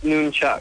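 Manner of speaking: "nunchuck" with a long oo, NOON-chuck; noon pronunciation. Shown as a demonstration. Caller teaching the host, "nunchuck" as NOON-chuck. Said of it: "it's pronounced nunchuck".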